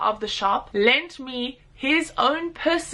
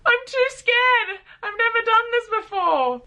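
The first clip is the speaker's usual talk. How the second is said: retold fear; 13 semitones higher than usual. Telling the biker in traffic she's too scared, high mock-frightened plea. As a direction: whimper it like a scared new rider. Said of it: "and I was way too scared".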